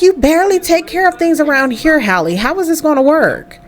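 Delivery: high-pitched motherly nagging; exasperated scolding. Acted. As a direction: nag the daughter, pitch up but not shouting. Mother scolding daughter in argument.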